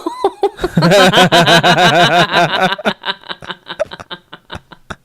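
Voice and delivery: long, loud burst of real laughter; big laugh trailing off. Real, not acted. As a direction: crack up helplessly at your own story. Cracks up after the retold quote.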